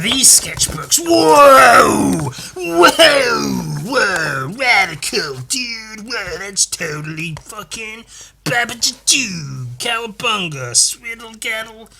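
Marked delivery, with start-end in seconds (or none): 1.0-4.5 big shouted whoas, high and loud; wild excitement. Acted. Thrilled by the new sketchbook. 4.6-11.9 lightly voiced stoner surfer hype; mock surfer dude.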